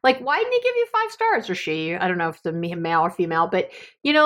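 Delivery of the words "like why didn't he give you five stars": higher-pitched, indignant retold rant; mild, high-pitched mock indignation; indignant outrage. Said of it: "my assistant at the time was so angry".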